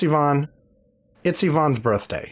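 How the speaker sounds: ordinary talk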